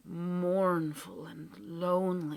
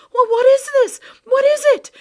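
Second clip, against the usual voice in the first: very high, loud frightened gasps; panicked; 21 dB louder, faster than usual. Acted. Boy caught in the whirlwind, panicked cry. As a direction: cry out in terror. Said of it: "gasped Ben in panic".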